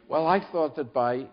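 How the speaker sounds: lightly acted desperate protest; mildly desperate. A narrator's reading. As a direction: try again, a touch desperate. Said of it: "he tried again desperately".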